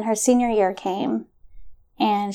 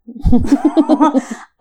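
a burst of sheepish laughter, higher and louder; embarrassed laugh; 5 semitones higher, 8 dB louder than usual. Real, not acted. Laughing at herself to the interviewer about snooping, sheepish laugh. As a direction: laugh it off, caught out.

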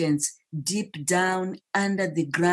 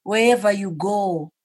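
louder, higher, building fervour; swelling to a passionate climax; rising passion; 4 semitones higher, 5 dB louder than usual. Real, not acted. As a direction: preach it with growing force.

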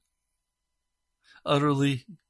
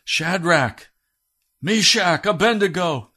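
loud, higher royal summons; shouting king; 6 semitones higher, 6 dB louder, slower than usual. Acted. King shouting into the blazing furnace. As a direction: call them out of the furnace with full force. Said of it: "and he shouts".

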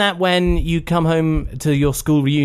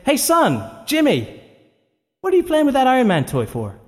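high-pitched mum calling her son; 10 semitones higher than usual. Acted. Mother calls to little boy with his toy, high-pitched calling. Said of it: "his mom was watching him, and she's saying".

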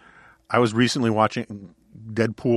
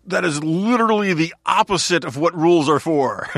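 higher, louder put-on character voice; Colossus; 8 semitones higher, 5 dB louder than usual. Host quoting a film's retort, loud put-on voice. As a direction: protest it loudly as a movie hero. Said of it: "And Colossus says".

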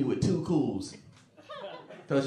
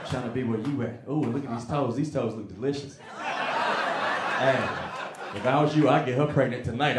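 sleazy charmer; 5 semitones lower, 4 dB quieter than usual. Retold or imitated. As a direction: lay it on thick as the creepy friend. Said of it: "he extra sexy".

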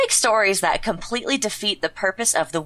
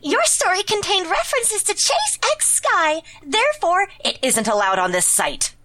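high squeaky character voice; 9 semitones higher than usual. Pretend. Role-playing Sir Blaze answering.

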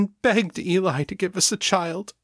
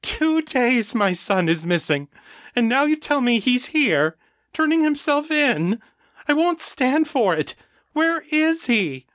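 anguished demand; 14 semitones higher than usual. Acted. Grieving mother demands to see son.

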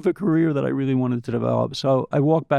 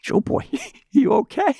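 high, laughing, mock-worried call; a pal popping his head over the cubicle; buddy checks on him, pitched high and amused; buddy's concerned question; 13 semitones higher than usual. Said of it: "He used to call me Joe Boy".

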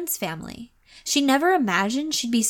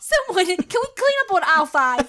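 store announcement; 8 semitones higher than usual. Pretend. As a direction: call it like a store intercom.

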